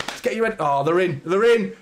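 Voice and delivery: rising excitement, voice jumping up; fan's sudden hope at a chance; excited. Real, not acted. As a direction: get swept up as the attack goes in. Chance on goal.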